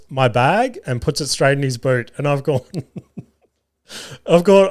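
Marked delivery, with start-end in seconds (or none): 3.4-4.7 laughing through the words; words bursting out in laughter. Real, not acted. Comedian laughing while telling of the pushy cab driver.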